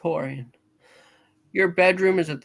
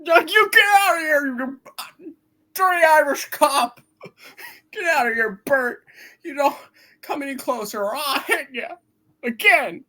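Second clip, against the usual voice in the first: much higher, loud, wild threats; frantic yell; 9 semitones higher, 4 dB louder than usual. Acted. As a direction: shout him off in a frenzy. Man yells at the cop Bert.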